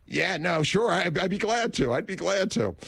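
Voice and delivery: high, eager rush to accept; overeager yes. Retold or imitated. Broke host eagerly accepting the radio gig, high and keen. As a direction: jump at the offer like a broke man. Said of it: "And I'm like".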